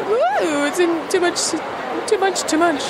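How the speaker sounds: high, breathless overwhelmed wail; mock-overwhelmed high cry. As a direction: voice the panic of too much to do. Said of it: "I do feel a little bit".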